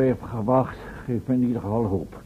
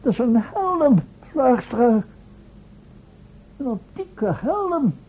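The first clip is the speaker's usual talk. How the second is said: excited reveal; 11 semitones higher, 4 dB louder, slower than usual. Acted. Professor reveals the found helmet.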